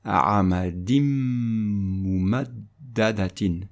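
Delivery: "a'amadim mumaddadatin" run together on a long hummed m; lingering nasal m linking "a'amadim mumaddadatin"; assimilated nasal pronunciation. Shown as a demonstration. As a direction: hold the m ending "a'amadim" into "mumaddadatin". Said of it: "Et on va prononcer".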